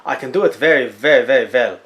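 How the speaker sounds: hammed-up French accent; cartoon French accent, pleading his case; bad French accent. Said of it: "if you think my French accent is bad".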